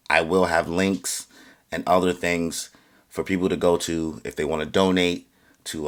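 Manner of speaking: usual speaking voice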